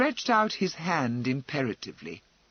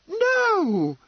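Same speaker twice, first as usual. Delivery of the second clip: exclaiming; 6 dB louder than usual. A narrator's reading. Serena protests Fanny's guilt.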